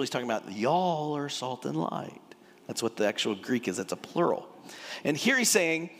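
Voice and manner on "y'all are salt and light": Texan drawl. Shown as a demonstration. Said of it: "this is Jesus' Texan".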